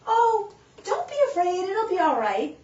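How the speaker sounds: high, loud reassuring granny voice; soothing grandmother. Retold or imitated. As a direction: comfort the child like a fretful grandma. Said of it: "his grandmother looks down and says".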